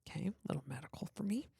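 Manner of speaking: fast whispered aside. Real, not acted. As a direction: whisper it quickly to yourself. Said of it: "aside about the submission".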